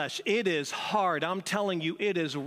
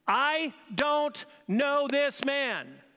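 slow, emphatic, higher-pitched denial; sworn denial; 7 semitones higher, much slower than usual. Acted. Peter lying to those standing around, slow emphatic denial. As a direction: drag out each word of the denial. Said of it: "again he lied and swore".

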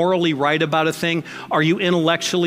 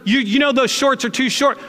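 higher-pitched scolding hall monitor; nagging hall monitor; 6 semitones higher than usual. Acted. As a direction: rattle off petty school-corridor reprimands. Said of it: "be the hall monitors of the world".

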